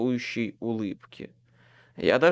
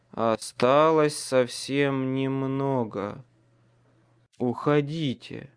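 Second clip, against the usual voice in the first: ordinary delivery, nothing marked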